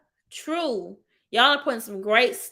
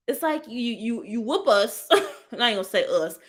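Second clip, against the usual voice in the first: usual speaking voice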